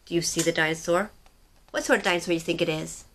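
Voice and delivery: ordinary delivery, nothing marked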